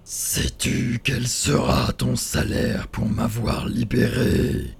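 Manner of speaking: slow, looming monster voice; menacing giant spirit. Acted. Freed spirit threatening the schoolboy, slow and looming. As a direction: draw it out like a giant.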